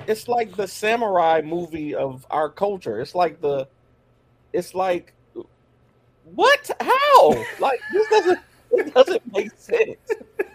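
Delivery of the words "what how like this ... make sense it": high, loud disbelief with a laugh; can't believe what you heard; incredulous. Real, not acted. Disbelief that he hates Westerns.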